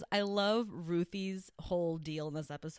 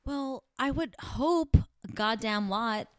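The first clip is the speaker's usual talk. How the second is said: slow, louder exasperated emphasis; 5 semitones higher, 6 dB louder, slower than usual. Real, not acted. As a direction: lean hard into exasperation. Exasperated about Lucy.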